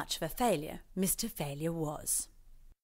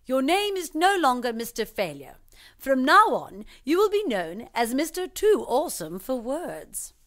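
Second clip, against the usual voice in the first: higher, louder grand pronouncement; loud proclamation; 6 semitones higher, 6 dB louder than usual. Acted. Judge proclaiming the new name, loud and grand. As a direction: proclaim it to the courtroom. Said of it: "the judge declared".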